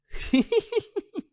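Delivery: high, sly little laugh; the mistress laughing at Connie, mocking; mocking giggle. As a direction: laugh at the wife.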